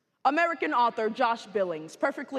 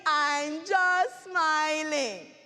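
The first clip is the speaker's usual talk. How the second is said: higher, very slow and stretched-out words; drawn-out playful answer; 5 semitones higher, much slower than usual. Acted. Brother answering his sister about his smile, drawn out playfully. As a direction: stretch each word out playfully.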